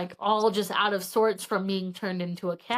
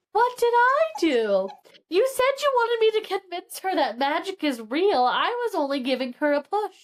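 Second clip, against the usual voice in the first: indignant aunt; 8 semitones higher than usual. Acted. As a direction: squeal it out as the wronged aunt. Voicing the aunt defending herself.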